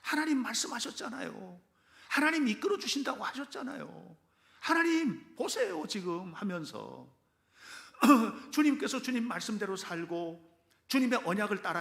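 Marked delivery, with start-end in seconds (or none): 0.0-6.3 higher, pleading cry to God, slowing at the end; wailing prayer demo. Shown as a demonstration. Preacher models a tearful plea to God, raised and pleading. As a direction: show them how to cry out to God. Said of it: "오셔서 통곡을 하세요".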